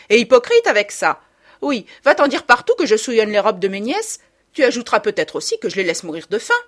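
loud, high, scornful aunt's tirade; indignant, hard-hit scolding; angry accusation. A narrator's reading. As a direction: lash out as the wronged party. Aunt accuses her niece.

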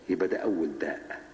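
ordinary talk, nothing marked

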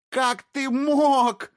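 high, slow, wailing scream. Acted. Wife screaming at her unfaithful husband, slow high wail. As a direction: howl the betrayal out. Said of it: "закричала Колет".

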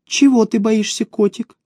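high-pitched, coaxing question; cooing woman. Acted. Elina questions her frightened boss, cooing high. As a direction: ask high, as if to a pet.

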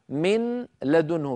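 slow من لدنه with the n sounded; unmerged nun. Shown as a demonstration. Teacher repeating nun then lam, من لدنه slowly unmerged. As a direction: hold the n of من apart from لدنه. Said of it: "فهنا أنا ألفظ نون بعدها لام".

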